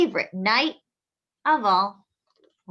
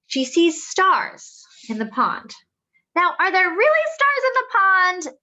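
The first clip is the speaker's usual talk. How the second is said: put-on higher and louder question to the kids; storybook reading; 7 semitones higher, 9 dB louder than usual. Asking children a question from the story, wondering. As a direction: ask the children with big wonder.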